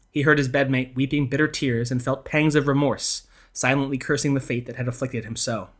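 ordinary delivery

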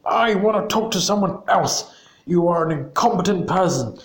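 high, breathy mock rage at the operator; hammed-up furious customer demanding someone else; retold customer rant, pitched well up; angry customer. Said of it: "he got really angry".

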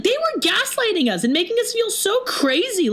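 high-pitched, indignant outburst; acting out a heated self-defence; worked-up protest. Friend arguing with Douglas about the bar, indignant.

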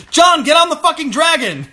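high, loud exasperated yell; urgent, high-pitched shout; screaming at the screen. Retold or imitated. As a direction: yell it like a frantic viewer. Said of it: "we were all screaming".

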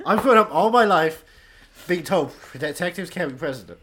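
loud, fired-up campaign speech; rally the crowd, all fired up; rousing speech. Pretend.

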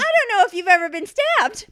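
loud, high, rapid protest; indignant outburst. Real, not acted. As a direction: fire back fast in comic indignation. Keeps up the comic protest.

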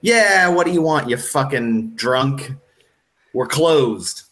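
high opening call that drops away; annoyed closing-time send-off; irritated pub owner. Acted. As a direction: call out dismissively to a shambling drunk. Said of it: "and he's like".

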